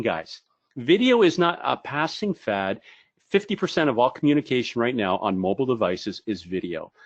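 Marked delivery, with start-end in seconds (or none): none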